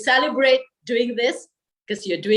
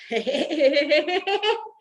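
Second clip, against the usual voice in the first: higher, bursting laugh; demo laugh; 6 semitones higher than usual. Shown as a demonstration. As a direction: break into laughter for the group. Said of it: "And we go".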